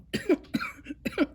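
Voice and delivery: weak, high-pitched feverish cough; sick cough. Acted. Feverish Parson coughing as his wife checks him, weak cough. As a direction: cough it out like a sick old man in bed. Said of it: "Parson began to cough".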